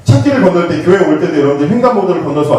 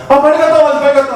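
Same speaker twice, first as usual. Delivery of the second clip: nagging mom; 9 semitones higher, faster than usual. Retold or imitated. Mother hurrying her daughter out, higher nagging call.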